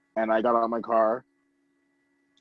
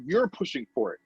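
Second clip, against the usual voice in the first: normal talking voice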